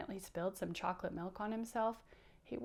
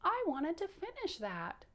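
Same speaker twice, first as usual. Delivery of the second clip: higher-pitched disappointed puppet whine; 8 semitones higher than usual. Pretend. Bouncer told to clean up mid-play, mildly disappointed whine. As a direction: let down, but only mildly upset. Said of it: "he might feel disappointed and say".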